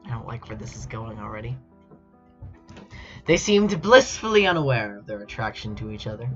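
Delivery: usual speaking voice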